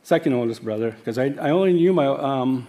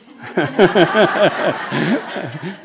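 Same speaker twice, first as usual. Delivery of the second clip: a bright burst of real laughter, higher and louder; 6 semitones higher, 7 dB louder than usual. Real, not acted. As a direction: laugh out loud, delighted about retiring. Laughs about upcoming retirement.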